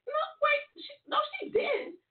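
high, cracking cry of disbelief; shocked disbelief. Retold or imitated. Retelling the mentor walking out mid-chat, shocked high squeal. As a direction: squeal it like you can't believe she just left.

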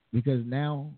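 normal talking voice

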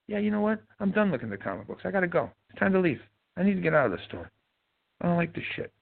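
high, jittery mock voice of a man bolting; mock nervous shopper. Man mimics a shopper in the next aisle deciding to flee, high and jittery. Mimicking guy fleeing the store.

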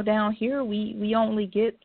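usual speaking voice

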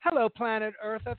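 ordinary talk

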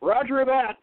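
high, loud quick confirmation; battle acknowledgment. Acted. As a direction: fire back the answer in combat. Gunner answers in battle.

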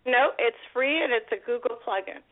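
ordinary delivery

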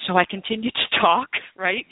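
ordinary talk

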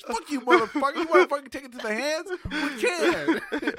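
loud, high mock threat; hammed-up angry challenge; mock tough talk. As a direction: pick a fight, voice way up. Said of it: "I don't have to tell them".

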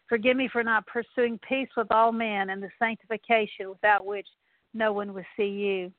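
ordinary talk

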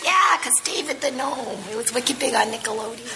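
light Boston "wicked big"; lingering Boston accent. Retold or imitated. Explaining David the Gnome, hint of Boston.

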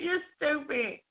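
normal talking voice

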